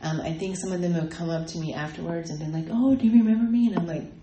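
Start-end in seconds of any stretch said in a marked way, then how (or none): 2.7-3.7 higher, much louder mimicked greeter; eager greeting. People greeting her afterwards, eagerly. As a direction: imitate someone keen to be recognized.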